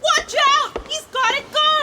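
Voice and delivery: very high, hammed-up warning scream; high comic shriek. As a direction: shriek like a cartoon lady in danger. Dame warns in comic skit.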